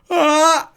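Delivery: sudden piercing high scream; dwarf's scream. Acted. Dwarf waking from stone lets out a piercing scream. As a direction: shriek as the oil hits the face. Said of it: "When, when the dwarf screams".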